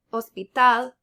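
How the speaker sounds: hospital stressed loudly on TAL, hospiTAL; stress on last syllable. Shown as a demonstration. Teacher giving learners an example, stressed hospiTAL. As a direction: punch the final TAL of hospital, Spanish style. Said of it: "stress on the very last syllable".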